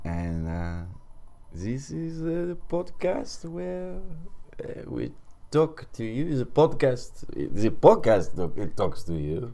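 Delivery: high, lilting mock-French accent; halting podcast intro in a French accent; hammed-up French accent. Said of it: "End of accent".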